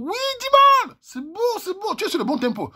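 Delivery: squealing approval, pitch way up; gushing high-pitched admiration; high delighted praise. Real, not acted. Praising the flirtatious line.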